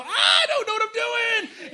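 high, loud, crying scream; screaming at God. Retold or imitated. Believer screams at God in frustration, loud and tearful. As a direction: wail it out at God in desperation. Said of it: "scream at him right in his face".

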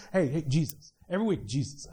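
soft, low hey hey Jesus; soft pointing. Pretend. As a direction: gently nudge them toward Jesus. Pastor pointing everyone to Jesus.

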